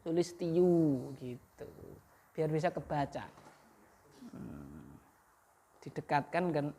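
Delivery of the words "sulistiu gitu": Sulistiu drawn out slowly; teacher modeling how Sulistiu is read; slow reading demo. Shown as a demonstration. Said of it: "Harus Sulistianya terus dikasih apa".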